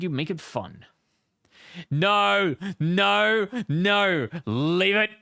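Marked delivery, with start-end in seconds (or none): 1.9-5.1 higher, louder no no no; loud protest. Real, not acted. Refusing the rabbit costume, loud laughing protest. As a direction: push it away loudly.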